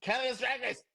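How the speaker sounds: high-pitched appeal to producers; playful plea. Pretend. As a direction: pitch it up and call out eagerly. Appeals to show to cast them.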